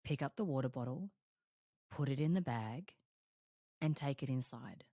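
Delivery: soft, low, steady orders to herself; calm self-instruction. Retold or imitated. Coach telling herself to take the water bottle inside, soft and calm. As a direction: instruct yourself quietly and plainly. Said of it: "I'll just say to myself".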